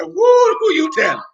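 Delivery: high-pitched whoop of agreement; excited drinker. Retold or imitated. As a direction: imitate a drinker hooting, pitch way up. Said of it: "He said".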